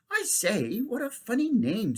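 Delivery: put-on high, amused girl's voice; teasing girl. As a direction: poke fun at his name. Girl teases the boy.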